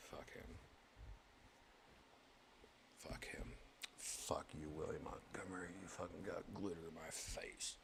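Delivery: soft, hissed curses under breath; muttered playful revenge whisper; mock grudge whisper. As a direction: whisper it like a secret threat. Secret grudge against William.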